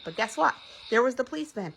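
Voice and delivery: plain reading voice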